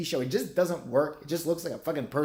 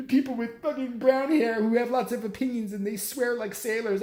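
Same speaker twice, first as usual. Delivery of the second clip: mock complainer voice; 7 semitones higher than usual. Mocking complainers.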